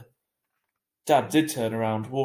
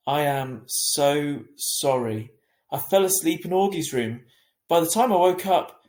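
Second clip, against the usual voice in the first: apologetic; 3 semitones higher, 6 dB louder than usual. A narrator's reading. Mum apologising to Via.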